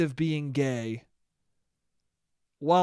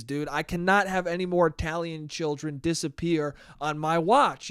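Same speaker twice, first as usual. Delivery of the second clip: ordinary talk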